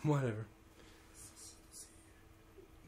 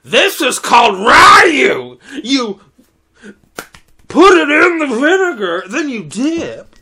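over-explaining shout; 7 semitones higher, 10 dB louder than usual. Retold or imitated. The guy next to me explains gyoza, loud and insistent. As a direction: bellow the instructions like a pushy know-it-all. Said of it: "the guy next to me goes".

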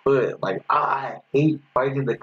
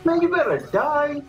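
exasperated threat; 14 semitones higher than usual. Real, not acted. Yelling at game opponent.

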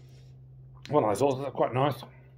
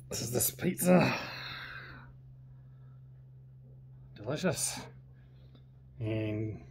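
whispering; 9 dB quieter, slower than usual. Real, not acted. Mouth full whisper over pizza.

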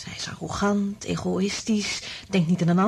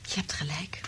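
awed whisper; 4 semitones lower, 11 dB quieter than usual. Acted. Stunned realisation about telepathic rats.

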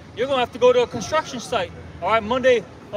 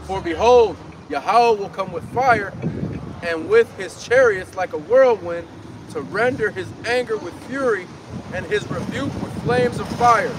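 preaching scripture; 3 semitones lower, slower than usual. Acted. Street preacher reading Isaiah to the crowd, slow and booming. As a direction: proclaim each line of Isaiah slowly.

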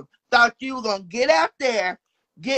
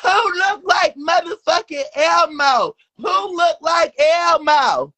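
angry shout; 3 semitones higher, slower than usual. Real, not acted. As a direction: scream the insult back. Heated insult-filled argument.

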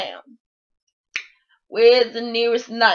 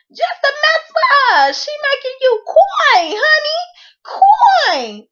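pitched way up, loud, indignant; outraged high exclaiming; 14 semitones higher, 4 dB louder than usual. Real, not acted. Vlogger ranting to viewers about the cheater, outraged squeal. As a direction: squeal it out in disbelief.

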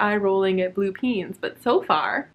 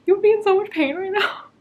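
whiny disbelief; 11 semitones higher than usual. Real, not acted. Incredulous reaction, whiny.

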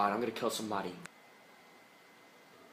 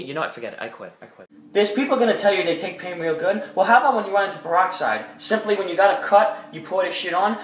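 ordinary delivery

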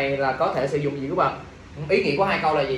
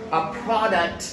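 product as PROD-uct, first syllable long; first-syllable stress; much slower than usual. Shown as a demonstration. Teacher models the noun for students, first-syllable stress. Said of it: "Product âm thứ nhất".

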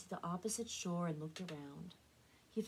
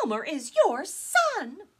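high, loud desperate plea; pleading; 9 semitones higher, 14 dB louder, slower than usual. A narrator's reading. Mama to Papa, high desperate plea. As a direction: beg him to see his son. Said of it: "Mama pleaded".

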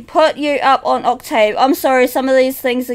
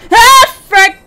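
startled curse; 6 semitones higher, 6 dB louder than usual. Real, not acted. Startled in game.

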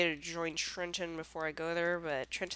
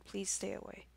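whispering; 10 dB quieter than usual. Real, not acted. Whispering while sneaking near enemy.

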